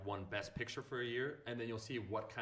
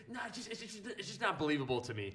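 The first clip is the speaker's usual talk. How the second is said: high, dismissive director voice; snooty director; 10 semitones higher than usual. Retold or imitated. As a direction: look down your nose at him. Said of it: "he's like".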